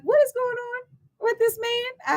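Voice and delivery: retold bewilderment in a very high voice; high-pitched bewilderment. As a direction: go way up high, acting baffled. Retold alarm at circling stranger.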